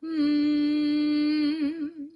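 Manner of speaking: a steady humming mm held in the nose; demo hum. Shown as a demonstration. Coach showing students a nasal hum. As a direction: hum it to feel the buzz in your head. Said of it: "put your fingers on the bridge of your nose and hum".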